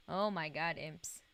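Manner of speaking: normal talking voice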